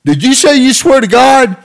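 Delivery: very high, loud incredulous question; mock amazed cop. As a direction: act stunned by the oath. Cop asks wide-eyed.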